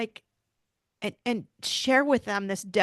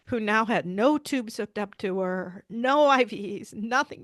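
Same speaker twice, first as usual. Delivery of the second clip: ordinary delivery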